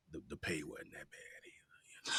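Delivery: hushed aside about the pay; whisper. Real, not acted. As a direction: whisper it like a secret.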